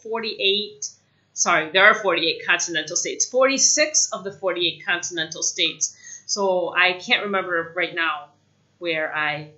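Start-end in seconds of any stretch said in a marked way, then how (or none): none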